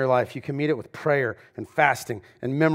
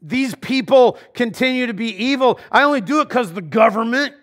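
whiny excuses; 6 semitones higher, 5 dB louder than usual. Retold or imitated. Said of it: "we're tempted to go".

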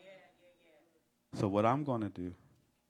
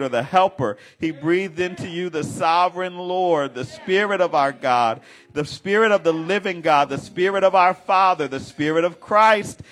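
loud, high, building preacher's cry; preaching at full force; 7 semitones higher, 13 dB louder than usual. Acted. Preacher crying out God's names to the saints. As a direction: lift the names up like a shout of praise.

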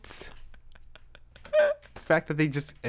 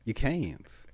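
mock preacher; 7 semitones higher, 4 dB quieter than usual.

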